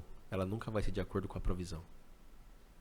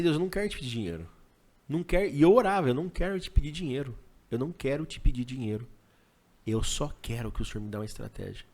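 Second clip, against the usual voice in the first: insistent prayer; 4 semitones higher, 9 dB louder than usual. Retold or imitated. Oração retomada com insistência.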